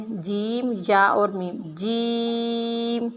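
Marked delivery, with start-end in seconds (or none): 1.6-3.1 جیم held very long and said firmly; long hard jeem. Shown as a demonstration. Teacher drawing out جیم slowly for the class. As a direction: stretch جیم out, teeth together. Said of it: "تھوڑا سا لمبا کر کے پڑھیں گے".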